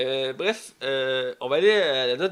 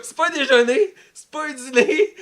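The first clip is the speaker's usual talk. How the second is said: amused quote, higher and louder; laughing Flash quote; 8 semitones higher, 9 dB louder than usual. Retold or imitated. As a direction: laugh through the quote, slow. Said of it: "C'est comme".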